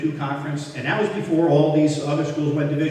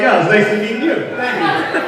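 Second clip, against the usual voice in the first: higher and louder, laughing sarcasm; mock-polite while laughing; sarcastic laughing thanks; 8 semitones higher, 7 dB louder than usual. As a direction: ironic nice-to-meet-you. Ironic retold reply.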